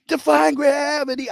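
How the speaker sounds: breathless excitement after a gasp; thrilled. Retold or imitated. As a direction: gush it like a starstruck fan. Leaving the movie thrilled.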